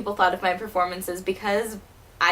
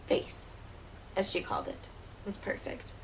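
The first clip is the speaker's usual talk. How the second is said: bitter aside in a whisper; 13 dB quieter than usual. Acted. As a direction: breathe it out, barely voiced. Bitter aside about mother.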